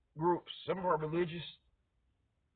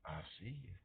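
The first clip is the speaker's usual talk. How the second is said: much softer, menacing line; soft menacing police warning; low cop threat; 6 semitones lower, 18 dB quieter, faster than usual. Acted. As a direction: warn them softly as you roll by. Police rolling by slowly.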